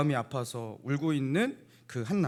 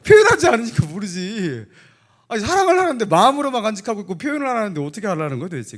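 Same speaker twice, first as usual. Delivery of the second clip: exasperated; 7 semitones higher, 9 dB louder than usual. Acted. Exasperated rhetorical rant.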